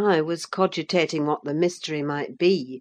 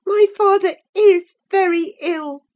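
Catherine; 9 dB louder than usual. A narrator's reading. Catherine's voice in narration.

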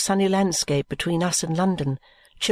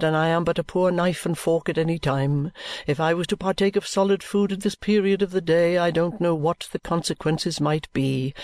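lawyer Vholes; 3 semitones lower than usual. A narrator's reading. Vholes declines lunch, low and sanctimonious.

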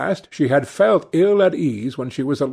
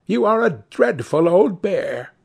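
higher, lightly acted teasing exclamation; affectionate cry; 7 semitones higher than usual. A narrator's reading. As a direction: tease a brother in a girlish voice. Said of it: "she cried".

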